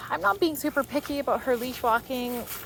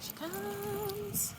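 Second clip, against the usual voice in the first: soft pleased murmur; 11 semitones higher, 13 dB quieter than usual. Real, not acted. Watching dog return.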